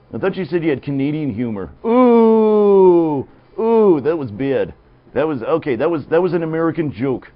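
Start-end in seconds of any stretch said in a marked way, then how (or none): 1.9-3.3 loud groan. Real, not acted. Groaning at a bad joke.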